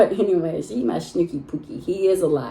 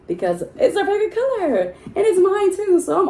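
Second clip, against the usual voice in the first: laughing gush; 13 semitones higher, 4 dB louder than usual. Real, not acted. Gushing about favorite color pink.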